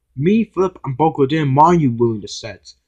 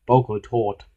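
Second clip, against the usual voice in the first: ordinary delivery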